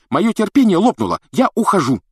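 high, loud, fed-up exclamation; angry outburst. A narrator's reading. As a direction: explode and storm out. Гермиона в гневе уходит. (гневно, во весь голос)

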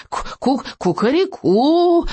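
high stuttering cock-a-doodle-doo; victory crow. A narrator's reading. Dragon celebrates scattering the herd, high victory crow. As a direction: crow triumphantly, stammering into a long high note. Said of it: "издал победный клич Беззубик".

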